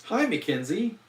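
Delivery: high, prim Downton Abbey greeting; chirpy upper-class British hello; posh British accent. Shown as a demonstration. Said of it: "Downton Abbey style is".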